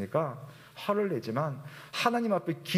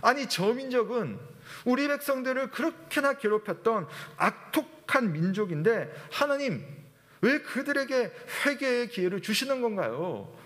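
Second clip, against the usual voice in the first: acted indignant complaint, higher pitched; angry protest; 8 semitones higher than usual. Jonah complains to God about sparing the cruel people, angry protest. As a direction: protest to God in mock outrage, voice raised in pitch. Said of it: "화가 나가지고 하나님의 말씀을 계속 거절하면서".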